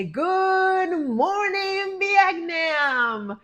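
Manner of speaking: high, loud, hugely stretched-out radio DJ greeting; Robin Williams impression. Answering her sister's morning greeting, loud drawn-out DJ call. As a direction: belt it slowly like the famous movie DJ. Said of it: "Remember that movie with, uh, Robin Williams?".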